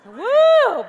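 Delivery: high, loud "woo" to reset; sudden ringing "woo!"; loud whoop. Real, not acted. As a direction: let out a big high whoop. Letting out breath after coughing fit.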